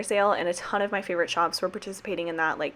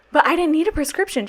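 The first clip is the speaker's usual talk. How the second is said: high whiny protest; 9 semitones higher, 5 dB louder than usual. Retold or imitated. Whining to mom.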